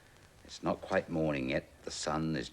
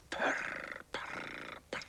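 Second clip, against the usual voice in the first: breathy unvoiced purring; cat purr; much slower than usual. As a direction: purr like a cat asleep on boxes. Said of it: "thought the cat".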